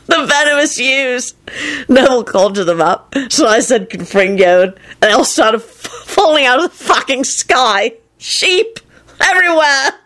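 high, gleeful, halting retelling; hysterical amusement. A narrator's reading. Lupin recounting the sheep disaster, helplessly amused. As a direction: climb in pitch as if barely able to finish. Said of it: "pounding on the floor with tears running down".

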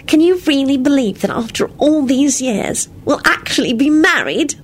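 high, tender wonder; loving, lilting question; sweet. Acted. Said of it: "Solange said sweetly".

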